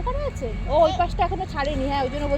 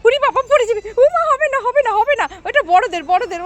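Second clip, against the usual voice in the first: excited; 9 semitones higher, 7 dB louder than usual. Real, not acted. Mother exclaiming to toddler at playground.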